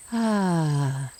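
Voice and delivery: long, slow sighed "ah"; audible exhale. Shown as a demonstration. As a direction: breathe out slowly on an open, falling "ah". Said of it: "slowly again with a nice audible".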